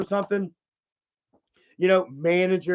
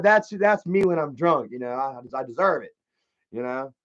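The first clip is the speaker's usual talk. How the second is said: ordinary delivery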